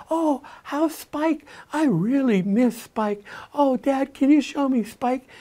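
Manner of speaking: put-on high, slow, eager child voice; light up like a small kid hearing his toy's name; excited little boy. Grandson begging his dad to see his stuffy, high excited child voice.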